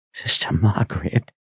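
shaky whisper. A narrator's reading. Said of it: "he whispered in a shaky voice".

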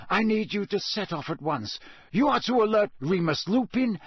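instructing Bill with firm urgency; Dumbledore urgent. A narrator's reading. Dumbledore gives orders.

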